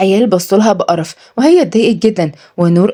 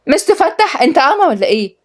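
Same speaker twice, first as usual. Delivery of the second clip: angry; 8 semitones higher than usual. Acted. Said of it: "وقفت نازلي بغضب".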